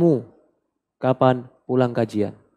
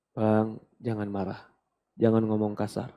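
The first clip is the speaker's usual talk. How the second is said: soft pleading to husband; 5 semitones lower, 11 dB quieter than usual. Shown as a demonstration. Wife softly advising husband again.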